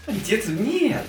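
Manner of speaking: high squeaky mock shock; mock surprise. Real, not acted. Teasing refusal, high pitch. (шутливое удивление)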